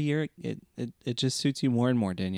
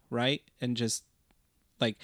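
ordinary delivery

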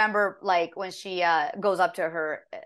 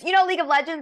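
raised, louder questioning voice; asking friends; 9 semitones higher, 5 dB louder, faster than usual. Retold or imitated. Telling how she asked her friends, raised questioning voice. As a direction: ask friends eagerly, pitched up.

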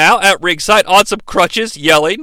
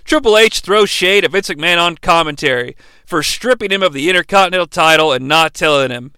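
ordinary talk

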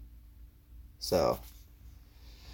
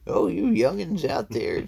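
put-on old man voice; 9 semitones higher, 5 dB louder than usual. Teasing the young listeners.

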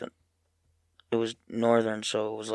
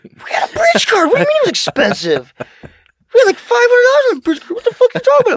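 shrieking, much higher and louder; mock screaming rage; 12 dB louder than usual. Son screaming at mom about the bridge card. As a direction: scream it as the outrage peaks.

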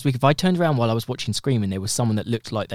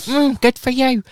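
slow, higher, sarcastic mm good for you; grudging congratulations; 12 semitones higher, 4 dB louder, slower than usual. Retold or imitated. Friend imitating her sour reply on a past episode, higher and slower. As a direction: say it through gritted teeth.

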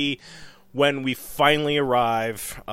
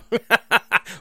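laugh; 8 semitones higher than usual. Real, not acted. Laugh after song.

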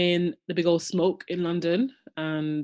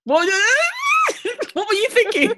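squealing with laughter through the question; laughing, high. Real, not acted. Amused host laughs at her co-host's rude guess, laughing high. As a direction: laugh out the question, much higher.

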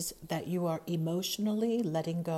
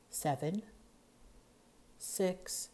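ordinary talk, nothing marked

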